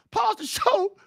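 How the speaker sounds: high mock wife's order; imitating wife. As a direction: bark it up high like his wife would. Mimics wife pausing his show.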